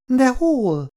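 high, reproachful plea; accusing the universe. Acted. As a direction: demand it of the whole world. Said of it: "olyan hangon, mintha az egész világ mindenséget akarná felelősségre vonni". (magas, szemrehányó kérdés)

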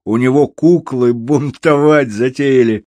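high, loud excited squeal; cobbler's high-pitched jeering cry. A narrator's reading. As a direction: squeal it out from the crowd. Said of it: "взвизгнул в толпе сапожник".

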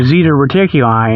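ordinary talk

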